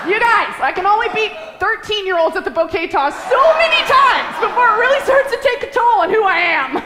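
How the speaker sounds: high, loud hammed-up complaint; mock exasperated protest. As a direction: play up your exasperation at the friends. Her own mock protest to friends.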